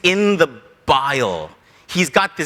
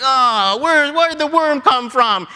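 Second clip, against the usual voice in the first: high-pitched wail of protest; hammed-up whine, pitched way up; mock whining complaint; 7 semitones higher, slower than usual. Jonah losing his shade to a worm, mock whining. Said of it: "here we find Jonah still complaining".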